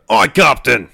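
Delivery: loud sailor's acknowledgement; crisp acknowledgement. Acted. Vodachin crewman answers the captain's advice, loud and obedient. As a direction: call it out to your captain.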